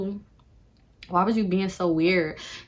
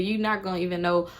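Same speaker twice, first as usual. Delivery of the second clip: ordinary talk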